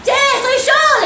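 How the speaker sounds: calm them down, playfully; mock reassuring. Preacher calming scared friends after crossing: slow playful reassurance.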